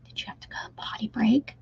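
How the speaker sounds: soft unvoiced whisper. Real, not acted. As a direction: whisper it like a secret to the chat. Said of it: "I don't know why I'm whispering".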